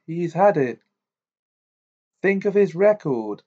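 brisk, pressing news; Amos Diggory. A narrator's reading. Diggory's head speaking.

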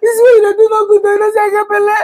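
high, crying complaint; hammed-up tearful scolding of husband; fake crying. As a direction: whine it like a wronged pregnant wife. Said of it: "Have you finished crying?".

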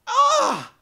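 very high, loud wailing shriek; anguished cry. Retold or imitated. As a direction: shriek out in dismay. Viewer dismay at dog's fate.

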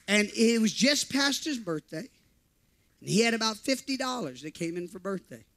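ordinary talk, nothing marked

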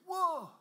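high, loud burst of "whoa"; awed whoa. Retold or imitated. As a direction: imitate people hit with a sudden loud whoa. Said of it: "They went".

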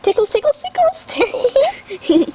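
high, giggly squeal; giggly, rising ticklish voice. Real, not acted. Being tickled while flippers go on.

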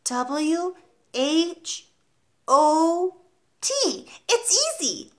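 high-pitched spelling, letter by letter; child reciting, sure it's easy; confident little boy. A narrator's reading. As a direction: pipe out the letters, proudly high. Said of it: "Eric said".

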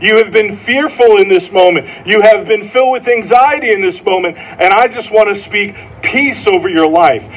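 earnest sermon delivery; preaching. Acted. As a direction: speak peace over the crowd. Preaching, speaking peace over listeners.

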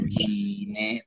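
"ine" low and soft as i-ne; as pronounced. Shown as a demonstration. Teacher shows pupils how it is pronounced, "ine" softly joined. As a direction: let the N of "ine" open NE. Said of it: "tal como se pronuncia".

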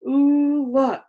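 delighted ooh. A narrator's reading. Chloe showing off her lunchbox, delighted. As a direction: exclaim it for everyone to hear.

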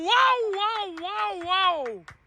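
high amazed wow cries; screaming football commentator. Real, not acted. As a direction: gasp in awe at the goal. Amazed at a curling goal.

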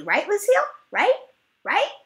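put-on begging kid, insistent; high pleading push. Junie B nags Lucille to agree monsters aren't real, high and pleading.

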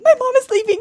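put-on high, loud whiny toddler cry; hammed-up baby whine; mock baby wail. Baby sees mom leaving.

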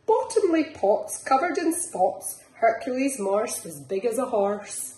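lilting picture-book reading; reading to children. Acted. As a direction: bounce the rhymes along for little ones. Reads picture book aloud.

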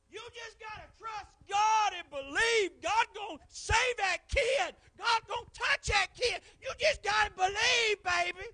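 high, slow, crying shout of faith. Retold or imitated. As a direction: preach comfort at the altar at full volume. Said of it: "she'd be like".

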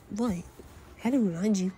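ordinary delivery

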